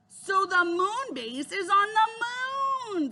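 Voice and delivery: amazed emphasis. A narrator's reading. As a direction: spell out the astonishing news slowly. Jack spells out amazing news.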